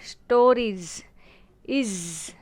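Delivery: "स्टोरीज", then its buzzed ending "इज"; buzzing iz ending. Shown as a demonstration. Teacher isolating the plural ending, "स्टोरीज" and "इज" buzzed. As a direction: draw out the z closing "स्टोरीज" and "इज". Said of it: "आईज़ेड का buzzing sound आ रहा है".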